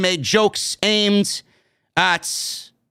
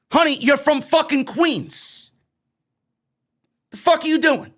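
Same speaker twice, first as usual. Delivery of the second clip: pitched way up, incredulous and sassy; sassy exasperation; 8 semitones higher than usual. Real, not acted. To Zelina Vega about her fake English accent, high sassy disbelief. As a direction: scold her like a disbelieving friend.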